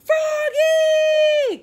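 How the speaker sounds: high, loud, long-held call. A narrator's reading. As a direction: holler the name across the house. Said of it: "called his father".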